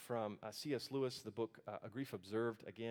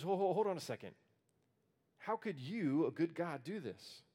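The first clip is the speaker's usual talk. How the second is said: startled protest; 4 semitones higher, 3 dB louder than usual. Acted. Prophet protests God's answer.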